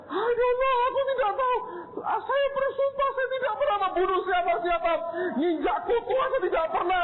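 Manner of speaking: high, sobbing cry of innocence; crying denial. Retold or imitated. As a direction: wail that you never killed anyone. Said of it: "nangis, nyembah-nyembah". (mahasiswa menangis keras menyangkal membunuh)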